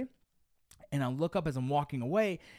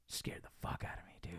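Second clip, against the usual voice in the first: hushed whisper; 13 dB quieter than usual. Real, not acted. Whispering to his friend about the scare. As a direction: breathe it out, barely voiced. Retelling a scary night encounter.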